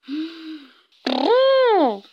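elephant's trumpet blast. A narrator's reading. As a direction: build up, then trumpet. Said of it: "他深深地吸了一口气".

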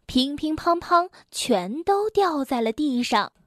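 ordinary delivery

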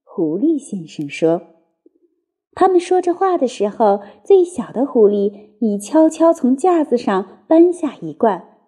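usual speaking voice

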